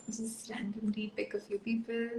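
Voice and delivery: whispered aside to self. Real, not acted. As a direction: murmur under the breath, voiceless. Aside while adding participants.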